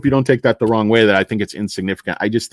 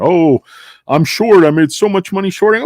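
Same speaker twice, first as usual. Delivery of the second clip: higher, louder mocking voice; mocking idiot traders; 7 semitones higher, 6 dB louder than usual. Streamer mocks short sellers bragging, high mocking voice. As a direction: mimic boastful fools, pitched up and loud. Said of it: "All these idiots talking".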